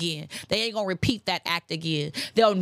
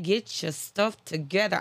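stern quote. Retold or imitated. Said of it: "He be like".

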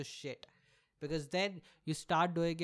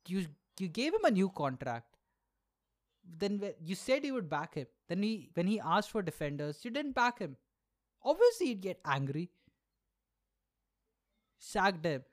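exasperated rant; 4 semitones higher, 4 dB louder than usual. Real, not acted. Ranting at club owners.

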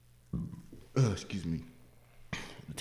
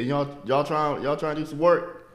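raised, higher-pitched drive-by holler; calling out; 7 semitones higher, 5 dB louder than usual. Acted. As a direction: call over to a group of workers. Calling out from a car.

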